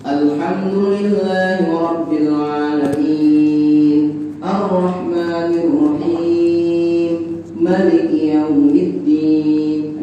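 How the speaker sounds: sung Quranic recitation; Fatihah recitation. Shown as a demonstration. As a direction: stretch the vowels in a melodic tajwid chant. Teacher recites Fatihah as demo.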